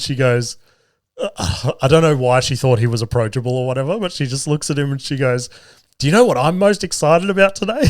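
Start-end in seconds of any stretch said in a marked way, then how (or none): 6.0-7.8 imitating a delighted girl, higher pitched; mock-excited question, pitched up high; happy, excited girl. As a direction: lift it up like a thrilled girl. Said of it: "So happy. Just so much joy".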